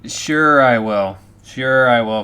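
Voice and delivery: sarcastic. Acted. Sarcastic reply to blackmailer.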